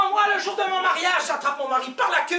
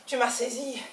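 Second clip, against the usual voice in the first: ordinary talk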